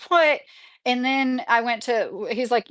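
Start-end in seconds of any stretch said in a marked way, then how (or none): 0.1-0.4 shocked what. Retold or imitated. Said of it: "I was like".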